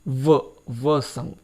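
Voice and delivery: व drawn out slowly, the consonant held clear; slow sound demo. Shown as a demonstration. Teacher slowly models the व sound. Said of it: "व की है".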